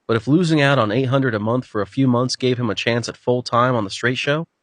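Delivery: ordinary delivery, nothing marked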